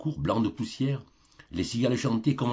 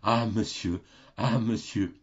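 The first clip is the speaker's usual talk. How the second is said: put-on stammering old man; slower than usual. A narrator's reading. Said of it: "il bégayait".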